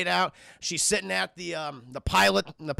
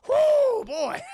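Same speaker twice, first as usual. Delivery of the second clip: relieved whoo; 6 dB louder than usual. Retold or imitated. Ripley's relief exhale.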